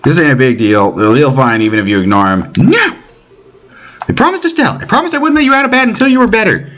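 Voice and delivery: put-on high, firm girl's voice; high-voiced girl insisting he stay in bed; stern little nurse. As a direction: order him back to bed, firm but small. Voices Tita scolding.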